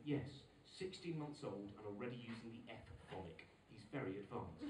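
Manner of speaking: low, soft, measured approval; cool nursery teacher. Retold or imitated. Mother-in-law assessing grandson's swearing, coolly approving. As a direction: imitate an unruffled teacher's assessment. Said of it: "very cool, um, nursery school teacher".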